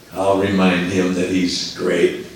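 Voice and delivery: a lightly voiced sly devil, low. The devil gloats about Jesus, low and sly. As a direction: sneer it like a scheming devil, under the breath. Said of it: "the devil says".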